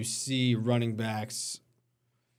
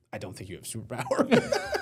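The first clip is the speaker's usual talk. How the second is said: breaking into laughter. Real, not acted. Host dismissing the coach's superpower claim, starting to laugh. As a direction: laugh creeping into the last words.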